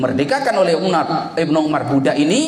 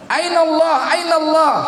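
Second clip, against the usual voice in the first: crying out. Retold or imitated. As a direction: cry out the question twice. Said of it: "shepherd's cry recalled".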